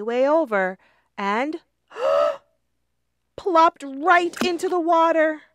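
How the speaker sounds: high, loud, with a gasp as she plops in; loud, high splash moment; dramatic fall. A narrator's reading. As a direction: gasp and tumble into the water. Lindy falls into the pond.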